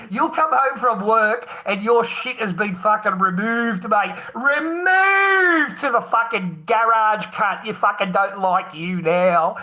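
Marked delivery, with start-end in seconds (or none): 4.3-7.3 slow, drawn-out shout, much higher and louder; outraged shout. Real, not acted. Husband ranting to friend about being moved, loud slow shout. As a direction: yell it out in disbelief.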